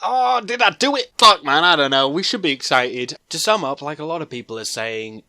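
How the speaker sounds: ordinary delivery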